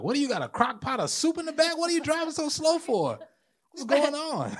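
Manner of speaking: hammed-up impatient motorist shouting out the window; mock irate driver. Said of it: "like, looking out the window".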